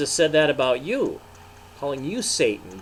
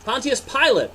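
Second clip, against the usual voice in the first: a much higher, louder call; high priest crying out; 13 semitones higher, 8 dB louder than usual. Acted. High priest calling out to the governor, loud. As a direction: call out the name sharply. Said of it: "the high priest cried".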